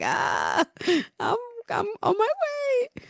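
high, breathy mock gasp of awe; mock swooning. She mocks her reaction to bathroom ab photos, high swoon. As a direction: swoon at the abs picture, hammed up. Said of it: "and I'm like".

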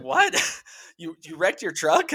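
high, incredulous imitation of the wife; shocked wife. Husband imitates his wife's shocked disbelief about the truck. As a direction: squeak out her disbelief.

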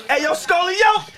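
high, slow safe-word holler; mock calling out. Man showing how he'd use his safe word, mock holler. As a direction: call it out drawn out and high.

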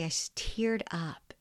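ordinary talk, nothing marked

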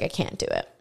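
understated retold despair; defeated. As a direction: drop it low and quiet, as if beaten. Retells giving up in low week.

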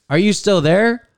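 checking in like a recorded operator, lifted at the end; quoted hotline operator. Retold or imitated. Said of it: "He says".

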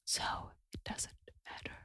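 demonstrating a hushed, voiceless whisper. Shown as a demonstration. Comedian showing the host that whispering still strains the voice, whispered. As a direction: drop right down to a bare whisper. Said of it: "Whispering is just the same as talking".

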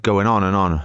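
ordinary talk, nothing marked

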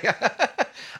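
high, rising burst of laughter. Real, not acted. Guitarist laughing over his agony at the gig, high laugh. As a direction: let the laugh climb high.

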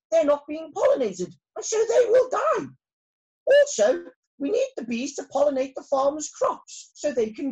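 high, loud and urgent royal speech; rally the bees with rising alarm; shouting queen. Acted. Queen bee at the special meeting, a high loud urgent queenly shout. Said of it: "she shouted".

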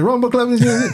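high, mock Jamaican patois; Jamaican accent. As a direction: brother calling him, mock Jamaican patois. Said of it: "just like you said".

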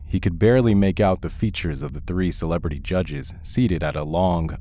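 plain reading voice

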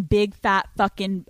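normal talking voice

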